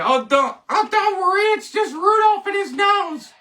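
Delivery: high silly reassuring voice; mock reassurance. Vlogger teasing viewers about Rudolph, high silly voice. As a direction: squeak it out like a cartoon helper.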